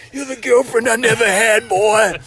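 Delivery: high and loud, mock-sentimental; hammed-up dying man. Dying man blurts a joke confession, loud and high. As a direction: ham up a deathbed joke. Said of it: "He goes".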